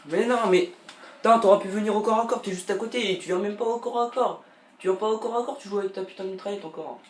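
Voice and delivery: loud high frustrated yelling at the screen; raging gamer. Real, not acted. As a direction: rage at the opponent, full voice.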